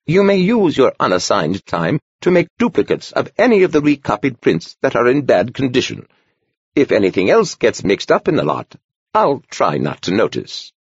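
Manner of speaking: lightly voiced old monk, a twinkle in it; amused old man. A narrator's reading. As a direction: give in with a knowing smile. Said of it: "his eyes twinkling slightly".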